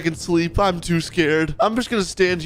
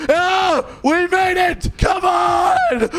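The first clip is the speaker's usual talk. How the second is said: pretend triumph; 11 semitones higher, slower than usual. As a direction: cheer like you just escaped. Escaping on the jet ski.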